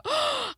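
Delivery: sharp re-enacted gasp; shocked gasp. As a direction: suck in a shocked breath. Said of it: "I go".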